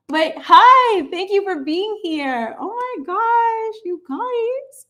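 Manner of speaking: high-pitched gushing, drawn out; delighted greeting. Real, not acted. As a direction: gush high and slow at seeing friends. Delighted greeting of returning viewers.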